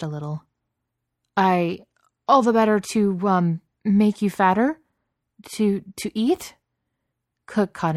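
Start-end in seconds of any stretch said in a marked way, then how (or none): 1.4-6.6 higher, halting, unsure threat; hesitant bluff. A narrator's reading. Beast trying to scare Cook, hesitant bluffing. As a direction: grope for a menace, ending in a question.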